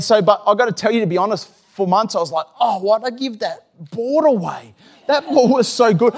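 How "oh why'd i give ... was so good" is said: whiny regret. Retold or imitated. Pastor retells his regret.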